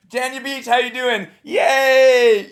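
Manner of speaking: high, loud hammed-up cheer; mock hype host. Coach mocking the comic's opening, hammed-up cheer. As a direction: overdo the hype. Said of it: "You're like".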